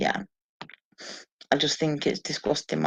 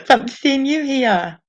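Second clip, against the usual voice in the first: said through a real laugh, pitched up; laughing greeting; 9 semitones higher, 6 dB louder than usual. Real, not acted. Greeting a viewer who just joined, laughing. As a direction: laugh your way through the welcome. Greeting a friend joining stream.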